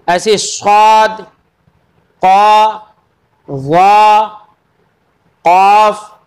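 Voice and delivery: गौद and वा recited as letter names. Shown as a demonstration. Teacher lists the heavy letters for students. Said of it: "हरूफ मुस्तालिया कहलाते हैं".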